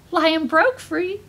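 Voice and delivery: ordinary delivery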